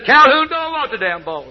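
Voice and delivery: the quarterback snapping back at the coach; punchline in a put-on voice; exasperated quarterback. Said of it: "the quarterback turned around and says".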